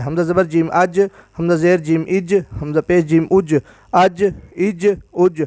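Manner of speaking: each اج a joined syllable ending in an echoed j; qalqala drill. Shown as a demonstration. Teacher drills jeem with jazm for students, qalqala release on each final j. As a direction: spell hamza, vowel and جیم, then bounce the j: aj, ij, uj. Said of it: "یہ بھی قلقلہ ہے".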